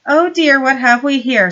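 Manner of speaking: mock surprise. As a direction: fake dismay, savouring it. Knock feigns surprise at locker.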